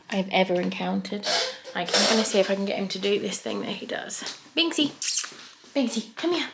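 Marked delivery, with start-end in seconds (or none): 4.6-6.5 high, bright-pitched cat call; calling a pet. Real, not acted. Owner calling Binksy to come and show a trick, calling. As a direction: coax the cat over in a raised pitch.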